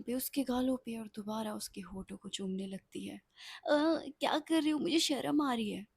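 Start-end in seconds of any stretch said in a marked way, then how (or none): 3.6-5.9 shy protest. Acted. Shy bride being kissed.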